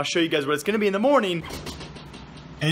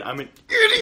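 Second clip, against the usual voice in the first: squeaky self-mock; 5 dB louder than usual. Real, not acted. Self-mocking outburst.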